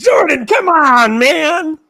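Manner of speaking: exasperated shout. Real, not acted. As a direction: cry out in disbelief at Jordan. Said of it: "just took a lot of psychic damage".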